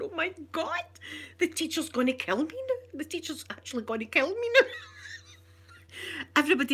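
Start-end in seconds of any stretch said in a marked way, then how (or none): 0.0-4.7 higher, mock panicked kid; panicked child. Girl hearing mum shout at the teacher, higher panic. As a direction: fret that the teacher will kill you.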